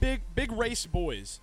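slow, high hype-man voice; hyped drawl. Acted. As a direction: stretch it like a hype announcer. Hyped show host.

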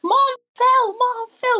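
put-on high, panicked cry of a child; panicked kid. Son calling out after mom falls, high panicked squeal. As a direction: squeal it like a scared little boy. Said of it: "the son's like".

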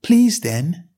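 put-on a well-mannered girl asking; young Alice. A narrator's reading. Alice asks the footman.

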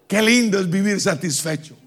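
normal talking voice

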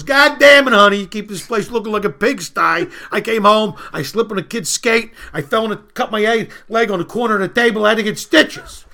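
loud, hammed-up griping; bitching husband. Husband comes home complaining to his wife, loud acted griping. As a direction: rant it out like a put-upon husband. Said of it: "starts bitching at his wife".

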